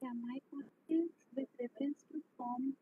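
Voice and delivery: faint and far from the mic; too quiet to hear; barely audible. Real, not acted. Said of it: "your voice is very low".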